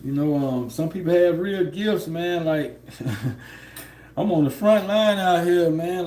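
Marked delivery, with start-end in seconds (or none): none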